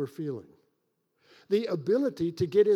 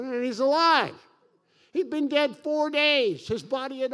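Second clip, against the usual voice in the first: higher and louder, pushing up to alive; emphatic build-up; 6 semitones higher, 3 dB louder than usual. Acted. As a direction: proclaim the miracle with rising force. Building the Lazarus miracle.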